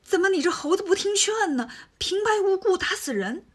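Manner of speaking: high, shaken reproach; alarmed and scolding at once; frightened scolding. Acted. Tang Seng scolds Wukong, frightened by the killing. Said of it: "吓坏了".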